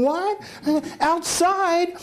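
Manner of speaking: high-pitched incredulous reaction; retold indignant squawk; mock outrage. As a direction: react appalled, pitch shooting up. Retold shocked reply to smoking request.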